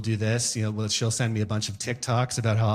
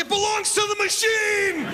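high, slow, shouted grand declaration; haughty. Retold or imitated. Refusing wife's request to check bin, lofty shouted. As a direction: proclaim it like royalty refusing a chore. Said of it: "I'm like".

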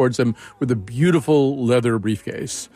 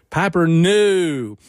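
put-on very drawn-out, higher Durham accent with a fronted O; Parker Posey; 4 semitones higher, much slower than usual. People imitating an actor's Durham accent, long drawled British-like O. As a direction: stretch it slowly in a mock Durham accent. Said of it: "people impersonate Parker Posey going".

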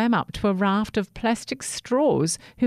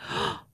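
gasp; 13 semitones higher, 6 dB quieter than usual. A narrator's reading. The plastic bag's fright.

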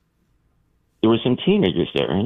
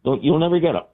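imitating the sheriff; 13 semitones higher than usual. Caller mimics the sheriff explaining saucers, high and casual. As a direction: toss it off like a bored small-town lawman. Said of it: "He goes".

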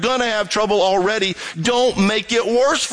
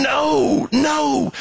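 pleading no; 7 semitones higher than usual. Retold or imitated. God warning the stubborn listener: urgent called-out no. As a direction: call out no as a warning. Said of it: "God is crying out to you going".

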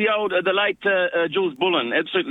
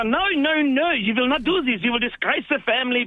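higher, scolding imitation of parents; mock stern parents; 7 semitones higher than usual. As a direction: wag a finger as the strict parent. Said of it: "and they go".

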